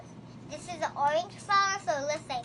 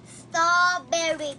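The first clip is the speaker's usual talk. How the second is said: slow model word; 6 dB louder, much slower than usual. Shown as a demonstration. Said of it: "teacher models word slowly, louder".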